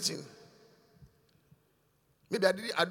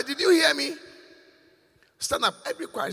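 ordinary talk

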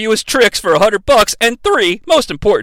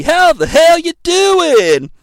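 hyped greeting; 9 semitones higher, slower than usual. Retold or imitated.

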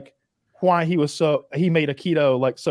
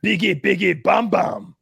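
higher, lightly mocking fake-language babble; imitated gibberish; 5 semitones higher, slower than usual. Mocking the star's made-up foreign dialect, pitched-up gibberish. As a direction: punch out nonsense syllables as fake speech. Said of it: "when you're just going".

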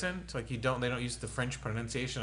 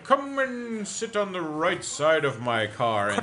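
mock British accent; 3 semitones higher than usual. Mocking British accent bit.

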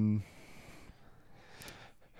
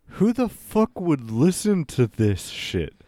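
higher-pitched, drawn-out incredulous complaint; mock outrage; 6 semitones higher, slower than usual. Host voicing a guest's imagined reaction to the show, mock outrage. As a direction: play an exasperated listener, slowly.

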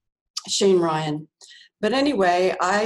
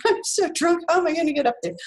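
giddy tipsy thought; 9 semitones higher, faster than usual. Retold or imitated.

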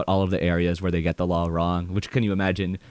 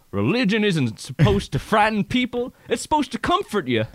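put-on high Southern preacher accent, pitched well up; 13 semitones higher than usual. [name] reciting his school-play preacher line in a Southern accent. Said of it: "you had to speak in a Southern accent".

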